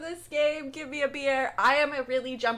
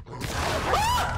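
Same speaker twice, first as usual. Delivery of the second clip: a sudden, much higher yelp; startled scream. Real, not acted. Gamer hit by a jump scare, startled scream.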